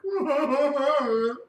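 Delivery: high-pitched fake sobbing; mock crying. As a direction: wail in mocking boo-hoo sobs. Mock crying after Harry's quote.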